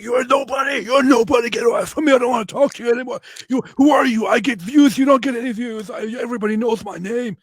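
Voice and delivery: high, loud mock shouting; Myron. Retelling the rival shouting at him, in a loud high mock rage. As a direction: yell it in an offended, high-pitched rant. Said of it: "He shouts at me and shouts".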